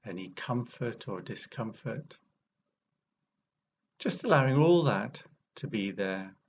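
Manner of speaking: unhurried meditation guide, long pauses between phrases; guided meditation. Real, not acted. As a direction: ease listeners into awareness, phrase by phrase, leaving space.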